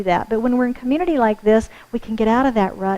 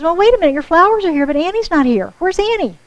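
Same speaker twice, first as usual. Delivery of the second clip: higher, louder, urgent asking; excited worried question; 6 semitones higher, 4 dB louder than usual. Retold or imitated. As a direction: voice the front-desk woman's alarmed surprise. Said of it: "She goes".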